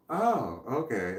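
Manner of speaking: understanding reaction. Shown as a demonstration. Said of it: "as opposed to".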